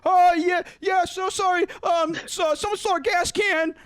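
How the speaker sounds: high, loud panicked babble; flustered and rushed, trying to smooth it over; imitating a panicked roommate; panicky reply. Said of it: "Bryson panics and he's like".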